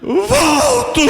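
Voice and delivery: high, drawn-out wailing answer; tearful cry. Retold or imitated. Son answering his mother through tears, crying out. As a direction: wail it as if sobbing, acted.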